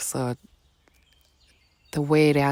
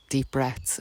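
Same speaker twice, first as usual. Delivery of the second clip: ordinary talk, nothing marked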